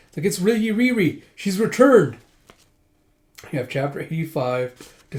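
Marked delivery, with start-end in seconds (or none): 0.3-2.2 high, loud, breathless news; excited announcement. Acted. Guard telling the lord Riri is back, high and loud. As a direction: burst in with the news.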